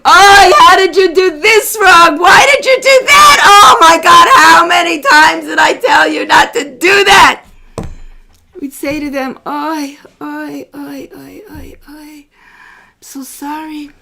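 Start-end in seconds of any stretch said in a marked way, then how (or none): 0.1-7.4 loud, higher scolding shout; angry parent yell. Acted. Parent yelling at a child who did wrong, angry shout. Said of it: "ever yell at them". 9.4-13.9 soft, fading, drawn-out commiseration; tender sympathy. Acted. Parent comforting a child who erred, tender and hushed. Models gentle reply to child.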